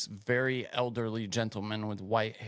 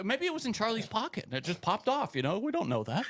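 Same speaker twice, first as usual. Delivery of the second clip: high-pitched mocking imitation; put-on high voice ridiculing someone's dumb theory; mocking stupid guy; 12 semitones higher than usual. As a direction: mimic a clueless commentator, pitched way up. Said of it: "That's the stupidest thing I've ever heard".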